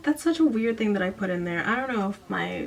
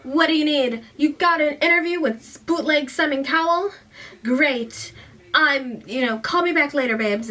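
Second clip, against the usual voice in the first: higher, louder mock big-shot voice; self-important phone manner; 6 semitones higher, 6 dB louder than usual. Mac taking Coco's call, mock high-powered.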